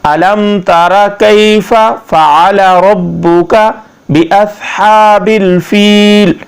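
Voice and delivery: slow tajweed with long held vowels; measured Arabic chant; flowing Quranic recitation. Shown as a demonstration. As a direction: recite the surah smoothly, stretching each verse ending. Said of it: "ज़रा रवानगी से पढ़ लेते हैं".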